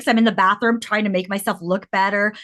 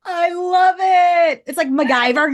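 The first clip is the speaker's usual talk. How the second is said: normal talking voice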